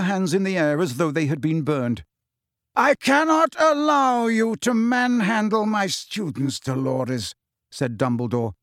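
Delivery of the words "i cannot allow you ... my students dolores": stern, slow and firm; angry. Acted. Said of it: "he looked angry".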